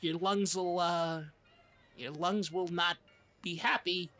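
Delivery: usual speaking voice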